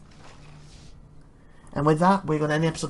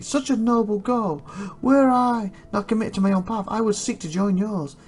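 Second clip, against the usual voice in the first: high, theatrical lament; moved knight, pitched up; mildly tearful; 7 semitones higher than usual. A narrator's reading. Knight wishing he could join the party, high tearful lament. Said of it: "Tears well up in Quan's eyes".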